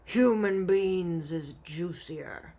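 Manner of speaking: man-eating giant unimpressed, very slow and heavy; brutish giant. Acted. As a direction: drag it out like a hungry brute. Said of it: "the blood bottler said".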